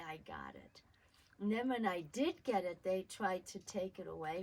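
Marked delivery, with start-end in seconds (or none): 0.1-0.8 1 triumphant whisper. Retold or imitated. Triumph after grabbing package.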